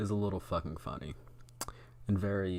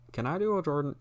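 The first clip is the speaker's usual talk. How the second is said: much higher, badly missed impersonation; failed Jordan Peterson; 7 semitones higher, faster than usual. Streamer testing an impression of a psychologist, high and way off. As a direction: try the voice up high and miss it. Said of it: "not anywhere close to Jordan Peterson".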